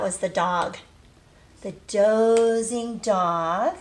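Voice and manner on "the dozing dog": slow and louder, stretching dozing; sleepy read-aloud emphasis; drawn-out storybook phrase. Acted. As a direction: linger on dozing like a yawn. Teacher dramatizes sleepy story character.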